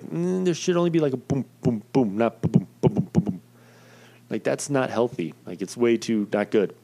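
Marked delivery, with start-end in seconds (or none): 0.0-3.4 higher, lightly voiced doctor tapping out booms; a hint of a doctor demonstrating the rhythm; doctor explaining. Doctor showing the heartbeat on the monitor, lightly voiced. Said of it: "And it was like".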